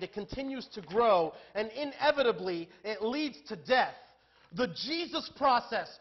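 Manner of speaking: high, very loud preacher's proclamation; full-force preaching. Real, not acted. Preacher warning the congregation that sin leads to death, full-force preaching.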